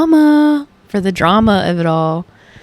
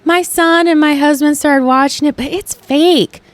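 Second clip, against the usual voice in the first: higher, light dismissive coworker voice; imitating coworker; 7 semitones higher than usual. Mocking the coworker who calls wrestling fake. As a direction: mimic her breezy shrug-off. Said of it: "she's like".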